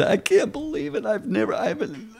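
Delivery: high, tearful disbelief through a laugh; retold crying joy. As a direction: voice her crying and laughing in amazement at once. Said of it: "now she's bawling, but she's smiling".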